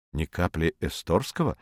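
low, soft echoing question on «Ни капли эсторского»; puzzled echo. A narrator's reading. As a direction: ask «Ни капли эсторского» back quietly. Rumata asks back, puzzled. («Ни капли эсторского» тихо, переспрашивая)

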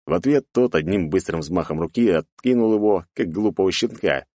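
plain reading voice

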